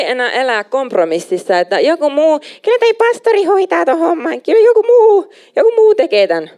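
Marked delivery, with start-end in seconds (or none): 1.8-6.5 much higher, louder mock whine of someone passing the buck; mocking excuse voice. Preacher mocks churchgoers who say someone else will do it, in a high mock whine. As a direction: parody the lazy believer who leaves it to the pastor.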